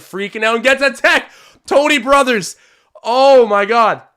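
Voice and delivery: excited outburst. Real, not acted. Streamer shouting at the ref's call, excited and loud. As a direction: blurt it out, thrilled at the call. Reacts to a technical foul.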